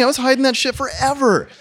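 ordinary talk, nothing marked

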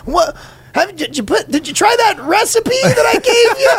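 very high, cracked caricature of a dad; put-on dad voice. Dad asks about his recipe, hammed-up high voice. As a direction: strain it way up into a silly high voice. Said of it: "Mister Dad".

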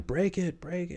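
high-pitched imitation of a coach yelling; coach's urgent shout, held back; mock yell. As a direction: yell it high but hold the volume back. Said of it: "My coach is yelling".

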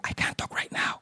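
hushed, voiceless whisper. Retold or imitated. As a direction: whisper it secretly into the phone. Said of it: "And I'm whispering".

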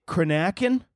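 "Cranachan" as cruh-NACK-en, questioning; unsure pronunciation. Shown as a demonstration. Reader guessing at a dessert name, "Cranachan" as cruh-NACK-en. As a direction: stress a short-A middle syllable in "Cranachan". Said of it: "I don't even know how to say".

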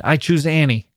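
a touch of malice on the choice; malicious. A narrator's reading. Cornered man choosing Annie, mildly malicious. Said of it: "His voice was strange and full of malice".

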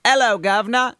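London accent. Shown as a demonstration. Host shows his go-to line, London accent. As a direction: put on a jaunty London Cockney accent. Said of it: "get into my London accent".

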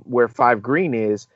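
ordinary talk

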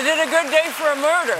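talking with a pencil in teeth; words round a bitten pencil. Shown as a demonstration. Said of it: "I put this in my".

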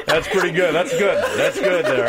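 laughing through the words. Real, not acted. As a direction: laugh it out, amused. Laughing at the wrestling belt joke.

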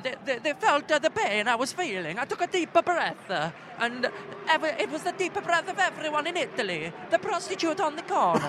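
halting, hammed-up Italian accent; mock Italian, searching for the words. Podcaster retells Pirlo's penalty, Italian accent. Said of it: "listen to [name]'s Italian impression".

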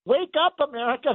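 high, fired-up call to wake up; rallying call. Real, not acted. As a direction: rouse the whole country. Radio host rallying listeners.

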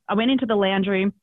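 normal talking voice

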